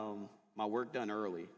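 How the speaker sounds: usual speaking voice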